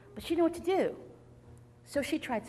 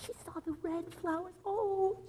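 plain reading voice

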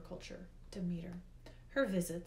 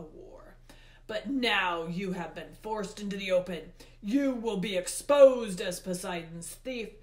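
loud, hard-hit accusation; Hades, accusing; 8 dB louder than usual. A narrator's reading. Hades names the boy as the thief, accusing. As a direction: denounce the culprit at full voice.

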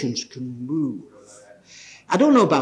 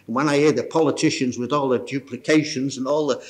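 ordinary talk, nothing marked

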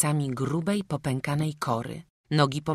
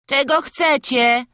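put-on high loud drowsy boy; a woken lad answering; sleepy voice; 11 semitones higher, 11 dB louder than usual. A narrator's reading. Frącek woken in the wagon, loud and sleepy. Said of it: "zaspany głos Frącka".

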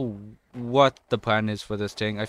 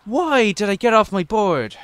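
high, loud indignant question; exasperated disbelief; 8 semitones higher, 5 dB louder than usual. Real, not acted. Gamer puzzled at falling off the board, exasperated cry. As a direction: wail at your own blunder, pitched up. Trick goes wrong.

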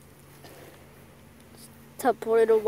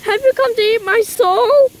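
frightened; 10 semitones higher, 7 dB louder than usual. Acted. Asking the creature in fear.

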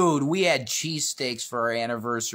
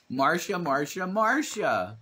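higher, slow drawn-out whine; jealous sister whine; 7 semitones higher, 3 dB louder, slower than usual. Retold or imitated. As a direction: moan each Marsha longer. Brady Bunch jealous sister quote.

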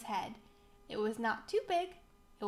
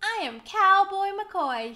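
loud, high-pitched announcement; proud shout; 8 semitones higher, 10 dB louder than usual. A narrator's reading. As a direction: shout your new name to the world. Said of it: "he shouted".

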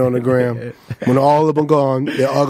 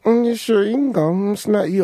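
higher, teasing hum; mock doubtful Keenan; 6 semitones higher than usual. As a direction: needle him with a sly little mm. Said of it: "Keenan was like".

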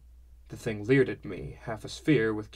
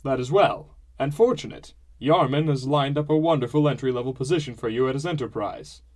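loud, higher hearty voice; pleased father; 4 semitones higher, 7 dB louder than usual. A narrator's reading. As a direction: announce it proudly.

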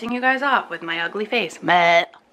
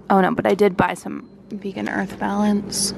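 usual speaking voice

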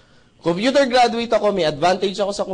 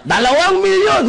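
hype shout; 12 semitones higher, 7 dB louder than usual. Retold or imitated. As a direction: belt it like a hyped-up seminar host. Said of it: "Nagsisigawan yung mga tao".

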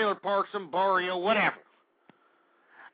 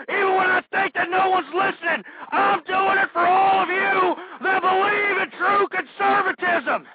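louder and higher with each clause; building shout; 9 semitones higher, 6 dB louder than usual. Acted. Host shouting his devotion to conservative listeners. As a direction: climb to a fervent yell.

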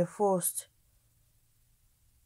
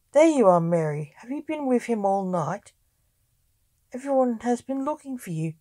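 loud opening, then higher-pitched accusation; accusing; 3 semitones higher, 7 dB louder than usual. A narrator's reading. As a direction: confront her as if caught out. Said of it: "accused Thomas".

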